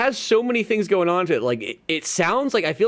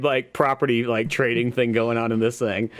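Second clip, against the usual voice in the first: ordinary talk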